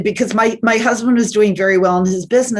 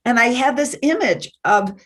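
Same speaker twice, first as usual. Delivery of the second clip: ordinary delivery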